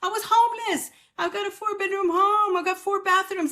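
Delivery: high, exasperated self-mockery; mock incredulous. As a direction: protest at your own ingratitude. Mocking her own endless complaining.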